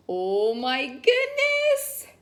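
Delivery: ordinary delivery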